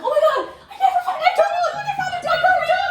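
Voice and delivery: high, loud frantic squealing; over-the-top shrieky retelling of a big find; frantic excitement. Retold or imitated. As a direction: yell it in a high-pitched, laughing panic. Retelling own frantic dog discovery.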